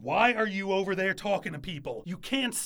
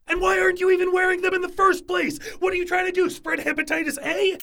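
sharp, much higher, louder scolding; angry yelling; 10 semitones higher, 4 dB louder, faster than usual. Acted. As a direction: yell at the cooks, quickening. Chef scolds kitchen staff.

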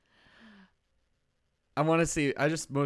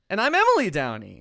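pitched up and louder announcer intro; higher intro; 5 semitones higher, 7 dB louder than usual. Shown as a demonstration. Redoing the intro at her brother's request, pitched higher. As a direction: lift it high like a show opener. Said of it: "There you go".

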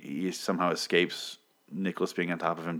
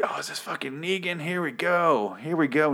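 eager, higher mock delight; excited; 8 semitones higher than usual. Fan hoping the villain arrives, mock excitement. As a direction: act thrilled a villain is finally here. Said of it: "I was like".

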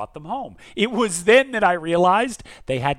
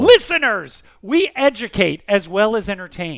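grand announcement; 4 semitones higher, 9 dB louder than usual. Acted. Addressing listeners grandly.

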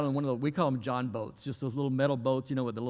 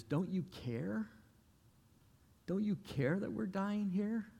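higher, softer, slow pleading; pleading question; 6 semitones higher, 6 dB quieter, slower than usual. Retold or imitated. Disciples begging the sleeping Jesus, soft high plea. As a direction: ask it small and desperate.

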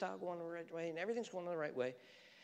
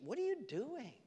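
high rebuke; 11 semitones higher than usual. Acted. God voiced, rebuking.

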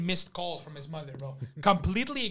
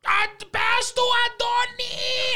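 high, loud drawn-out mock scream; exaggerated long yelling impression; imitating screaming mom; 5 dB louder, much slower than usual. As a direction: screech it like an enraged mother. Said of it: "And she's just screaming".

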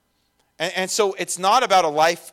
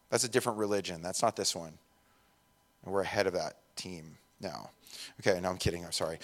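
quiet aside; 8 semitones lower, 10 dB quieter, faster than usual. Real, not acted. Quiet joking aside then retraction.

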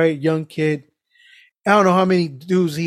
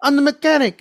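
high-pitched, agitated Spanish-accented mimicry; voice changing; 9 semitones higher, 3 dB louder than usual. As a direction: push the pitch up as he gets agitated. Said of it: "his voice started changing".

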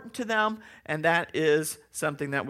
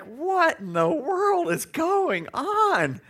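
high, slow exasperated disbelief; high drawn-out what is going on; slow baffled; 11 semitones higher, much slower than usual. Retold or imitated. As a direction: stretch it out, totally bewildered. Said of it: "I was like".

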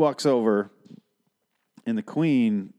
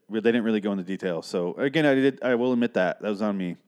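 usual speaking voice

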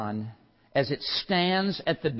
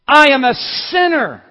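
much higher and louder, anguished; shouted confession; 13 semitones higher, 15 dB louder than usual. Acted. Sinner admitting sin to God, shouted anguish. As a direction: cry out the admission.

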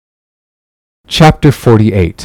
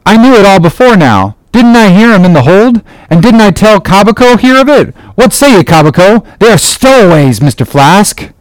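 high-pitched, eager telling; sailor character; 9 semitones higher than usual. A narrator's reading. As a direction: insist on it in a high voice. Archie's speech in character voice.

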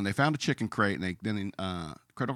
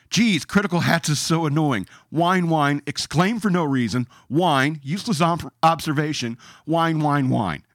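mocking comment read; 4 semitones higher, 7 dB louder than usual. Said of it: "Here's my comment".

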